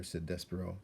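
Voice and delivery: usual speaking voice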